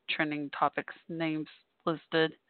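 normal talking voice

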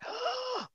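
sharp high intake of breath; a quick, startled breath in; retold gasp. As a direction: gasp in sudden shock. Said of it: "that's when I went".

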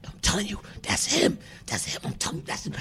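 hushed, urgent mock whisper; imitated furtive whisper. Couple arguing near actor over who he is, whispering. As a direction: whisper it, insisting under your breath.

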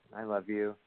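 slightly lifted, still half-hearted. Acted. Husband's second attempt for partner, barely convincing. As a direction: try again without much conviction. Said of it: "that's not a whole lot better".

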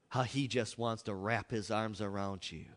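ordinary talk

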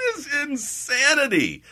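laughing. Real, not acted. Laughing at the story.

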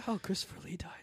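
hushed whisper. Real, not acted. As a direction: whisper it like a secret. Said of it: "Why are we whispering?".

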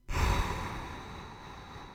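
long breathy sigh. Real, not acted. Sighs after long analogy.

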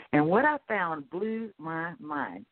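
ordinary talk, nothing marked

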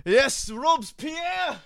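hammed-up French accent, high and slow; a mock French revolutionary hamming it up; bad French accent. Host mocking the French barn name, bad French accent. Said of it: "probably the worst French accent".

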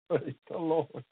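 soft laugh through praise the Lord; laughing softly. Real, not acted. Preacher laughs quietly at his own story, soft and slow. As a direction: let a quiet laugh carry it.

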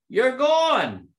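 high, loud yell at a colleague; retold yell. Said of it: "he yelled at me".

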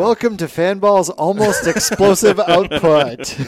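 big slow announcer intro; show host voice. Pretend. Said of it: "[name] hosts".

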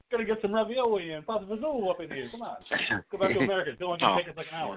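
high, hammed-up New York accent. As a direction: put on a loud mock New Yorker. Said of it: "New York accent. But yeah, just like".

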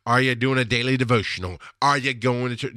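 ordinary talk

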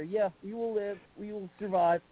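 ordinary delivery

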